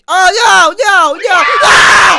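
very high, loud screaming no's; terrified scream. Real, not acted. Caught by the jump scare, screaming in terror.

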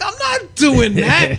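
high, loud, stretched protest; indignant refusal. Retold or imitated. Wrestler refusing to unmask for promoter, loud indignant refusal. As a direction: dig in with a drawn-out shout.

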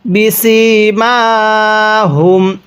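বিসিমাহুম with the final ম hummed long through the nose; গুন্নাহ করে; nasal ghunnah. Shown as a demonstration. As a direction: hold a nasal hum on the ম of বিসিমাহুম. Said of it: "মিম সাকিনটা আমরা গুন্নাহ করব".

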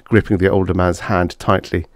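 ordinary delivery

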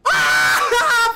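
very high, loud victory shriek; piercing celebratory yell; real excited scream. Real, not acted. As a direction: scream in triumph at the top of the voice. Screams at a lucky pull.

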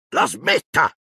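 high, urgent command; Zio Vernon, ordering. A narrator's reading. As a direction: cut him off with a high-pitched order. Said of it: "gli intimò".

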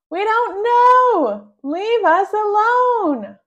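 high, loud and slow cry to be left alone; exasperated shout. Acted. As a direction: shout it, fed up with the questions. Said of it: "they shout".

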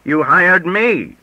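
high, loud, drawn-out outburst; shouted. A narrator's reading. Rejected job applicant insisting to the editor he was hired, shouted. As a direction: shout it slowly, pitch leaping up. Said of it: "and then shouted".

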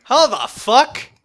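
drawn-out outraged yell; loud disbelieving yell. Real, not acted. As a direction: shout it slowly at the screen. Reacting to game.

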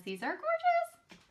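squealing over the purple pearls; delighted squeal. Real, not acted. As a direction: squeal it like you can't contain it. Admiring pearls to David.

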